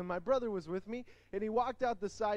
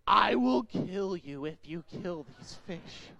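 put-on slow, louder threat from the girl; menacing girl; 5 dB louder, slower than usual. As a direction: growl a slow warning. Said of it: "and she goes".

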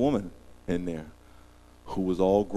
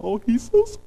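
touched wife; 5 dB louder than usual. Retold or imitated. Wife crying, voiced high.

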